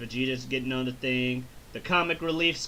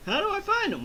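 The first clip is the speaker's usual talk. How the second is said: much higher dopey voice; dim Goku; faster than usual. Retold or imitated. Goku asking King Kai, high dopey voice. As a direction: ask like a clueless kid.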